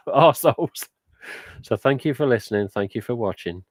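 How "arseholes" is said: laughing out the cheeky word, higher; a real laugh on a playful insult. Real, not acted. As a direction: giggle through the rude word. Jokey insult to viewers, laughing.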